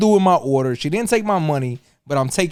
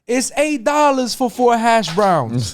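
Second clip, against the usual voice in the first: slow, higher mock manager voice; slow, higher imitation of a fast-food manager; 7 semitones higher, slower than usual. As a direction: drag it out like a bored manager. Manager quoted at the window.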